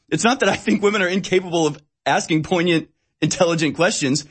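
usual speaking voice

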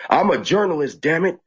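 half-strength yell in protest; retold yelling back. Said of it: "And I yell back".

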